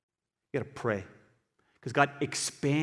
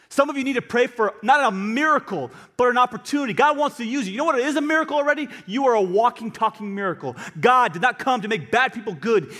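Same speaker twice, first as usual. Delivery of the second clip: loud, high-pitched impassioned preaching; building cry that eases at the end; full-force preaching; 9 semitones higher, 7 dB louder than usual. Real, not acted. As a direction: drive it home to the congregation.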